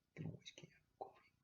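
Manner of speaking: fast breathless whisper; quick whispered aside. Real, not acted. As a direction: whisper it like a secret. Whispered repeat of coffee line.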